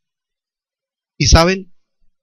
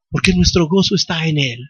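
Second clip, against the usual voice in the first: ordinary talk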